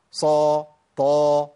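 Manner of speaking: صا and طا held level, fully emphatic; very heavy letters. Shown as a demonstration. Teacher demonstrating itbaq letters, strong emphatic sound. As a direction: hit صا and طا heavily and hold them. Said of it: "حروف إطباق".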